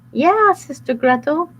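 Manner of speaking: high put-on Gretel voice; overly sweet, playing innocent; fake sweet girl. Wolf disguised as Gretel luring cookie, sweet.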